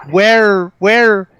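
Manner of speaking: showman's insistent, spaced-out query; theatrical demand. Acted. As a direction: ring out each word grandly. Harris grandly questions the agent.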